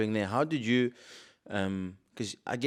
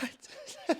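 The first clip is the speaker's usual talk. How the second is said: laugh; 5 dB quieter than usual. Real, not acted. Laughing at his own story.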